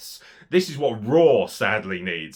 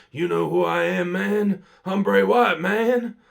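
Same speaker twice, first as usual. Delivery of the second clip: higher, louder mocking wrestler promo voice; mock Bray Wyatt promo; 8 semitones higher, 7 dB louder than usual. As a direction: ham up a lazy wrestler promo. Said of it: "he literally gets on the mic and goes".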